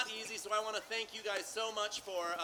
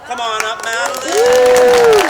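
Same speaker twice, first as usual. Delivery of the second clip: calling out; 8 semitones higher, 22 dB louder, slower than usual. Real, not acted. Calling director up on stage.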